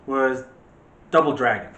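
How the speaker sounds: ordinary talk, nothing marked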